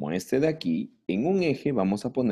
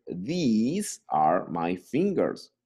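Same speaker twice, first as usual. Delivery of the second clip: slow model sentence; much slower than usual. Shown as a demonstration. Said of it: "slow model sentence".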